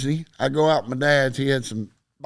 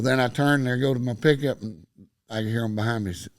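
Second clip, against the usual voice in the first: ordinary talk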